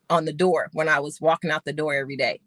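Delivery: normal talking voice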